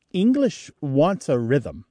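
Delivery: ordinary delivery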